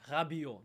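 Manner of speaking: Rabiot with a throaty French R. Shown as a demonstration. French speaker showing how we would say it, French R. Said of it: "That's how we would say it".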